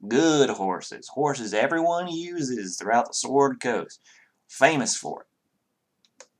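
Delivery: reluctant Southern accent, hammed up for the table. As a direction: play it up as a friendly Southern stable hand. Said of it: "abhor the southern accent".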